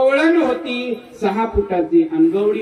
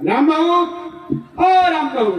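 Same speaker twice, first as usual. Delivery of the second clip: calling out; 9 semitones higher, slower than usual. Acted. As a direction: hail the neighbour from outside the door. Calling a neighbour from outside.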